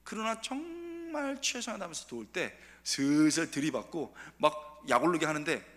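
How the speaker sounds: ordinary talk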